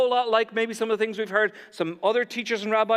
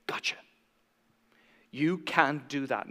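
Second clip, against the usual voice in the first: soft, whispered catch; pouncing whisper; 7 semitones lower, 6 dB quieter than usual. Acted. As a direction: whisper it like a lurking accuser. Pharisees pounce like vultures.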